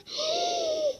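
high, sharp intake of breath; shocked gasp. Real, not acted. She slips out of the level by mistake, shocked gasp. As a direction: gasp in horror.

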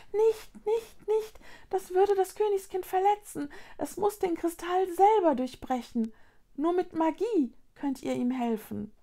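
very high little voice, urgent; alarmed Luni creature. A narrator's reading. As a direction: warn anxiously in a tiny high voice.

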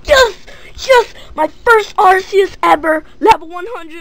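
high, loud cries of joy; real thrilled squeals; ecstatic shrieking. Real, not acted. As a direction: scream with delight at the pull. Pulls a rare Arceus card.

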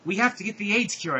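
high, loud urgent shout. Acted. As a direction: shout it to your partner. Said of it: "I shouted to Draco".

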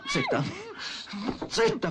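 a sudden squeaky high "Zitta"; sharp shushing. Acted. Thief silencing the tied-up woman, high and sharp.

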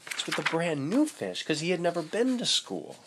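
plain reading voice

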